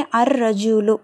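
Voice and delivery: held R joining "அர் ரஜூலு", no L after A; silent lam. Shown as a demonstration. Teacher shows lam unsounded, held R in "அர் ரஜூலு". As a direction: go straight from A into doubled R in "அர் ரஜூலு". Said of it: "லாம் உச்சரிக்கப்படலை".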